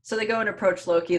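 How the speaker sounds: ordinary talk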